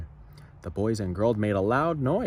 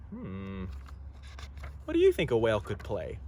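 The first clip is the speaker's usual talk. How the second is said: usual speaking voice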